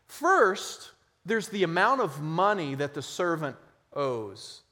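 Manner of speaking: normal talking voice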